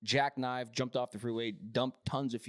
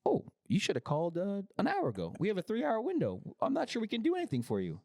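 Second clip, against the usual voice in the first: higher, quicker mock customer service lady; imitating the agent; 7 semitones higher than usual. Mimicking the phone agent to friends, higher and quicker. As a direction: rattle it off like a bored agent. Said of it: "The lady was like".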